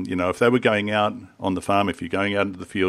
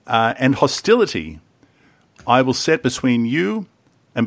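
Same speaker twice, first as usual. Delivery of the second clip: raised, measured Bible reading voice; scripture reading; 4 semitones higher than usual. Acted. Reading the verse aloud to the group, solemn and measured. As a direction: read the curse out like a solemn proclamation.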